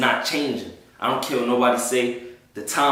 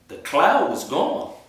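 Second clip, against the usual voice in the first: amazed; 8 semitones higher, slower than usual. Real, not acted. Amazed the cloud vanished.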